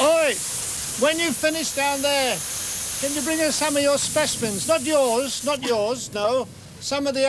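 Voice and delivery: hollering to the flick man down the garden; calling out. Real, not acted. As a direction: shout over to the man spraying the garden.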